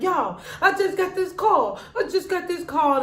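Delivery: high-pitched frantic retelling with a laugh; panicked. Retold or imitated. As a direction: squeal it out like a panicked friend. Jackie panicking, retold.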